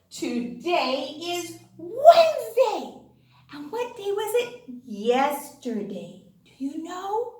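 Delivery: ordinary talk, nothing marked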